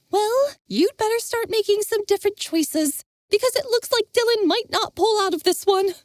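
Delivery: a bitter parting warning; Jenna. Acted. Jenna warning Envis, angry.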